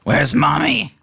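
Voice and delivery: high, loud, sneering little kobold; high, mocking kobold voice; taunting kobold. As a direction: jeer at him like a nasty creature.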